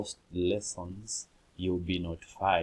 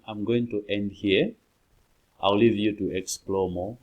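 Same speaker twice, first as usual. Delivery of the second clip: ordinary talk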